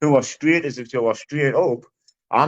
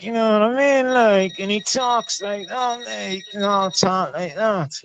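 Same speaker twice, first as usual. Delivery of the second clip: junkie's voice; 6 semitones higher than usual. Retold or imitated. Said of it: "'cause he talks like that".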